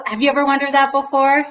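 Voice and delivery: normal talking voice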